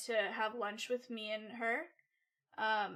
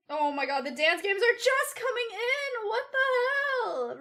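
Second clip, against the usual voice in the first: incredulous outburst; 11 semitones higher, 7 dB louder than usual. Real, not acted. Streamer astonished as delayed chat messages come in, incredulous outburst. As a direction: pitch climbing high into a loud exclamation.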